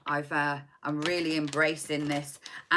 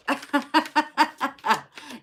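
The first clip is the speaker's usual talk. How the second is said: laughter; 9 semitones higher, 7 dB louder than usual. Real, not acted. Laughs at her own accent.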